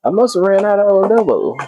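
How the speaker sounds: high, whiny dismay at running out; mock distress at the empty shelf. Real, not acted. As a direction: squeak it out in disbelief. Dismayed at missing seasoning.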